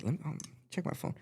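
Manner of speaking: soft whispered aside to self; whispered to himself. Retold or imitated. Mid bite of a burger, muttering to himself in a whisper. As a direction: breathe it out under your breath.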